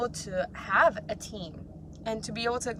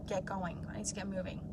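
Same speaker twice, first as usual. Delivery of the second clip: quiet self-talk; 3 semitones lower, 12 dB quieter than usual. Retold or imitated. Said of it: "have an honest talk with yourself".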